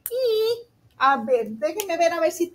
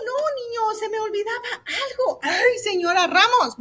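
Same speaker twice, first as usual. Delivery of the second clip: mock dismay; 6 semitones higher than usual. Teacher to pupils, almost forgot something, mock dismay. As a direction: scold yourself lightly in mock dismay.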